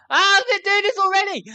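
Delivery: frantic shout; panicked outburst. Real, not acted. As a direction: yelp as it all starts at once. Game gets hectic.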